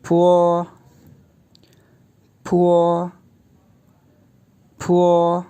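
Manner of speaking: फो said as an aspirated po with a puff of air; फो, breathy p, then a rounded o; फो like the Mandarin syllable po; pinyin po. Shown as a demonstration. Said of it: "यूँ pronounce होता है ये".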